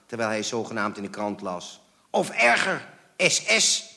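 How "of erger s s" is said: high, loud, furious addition. A narrator's reading. Father adding worse suspicion about owners, furious. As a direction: throw it in with rage. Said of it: "voegde hij er woedend aan toe".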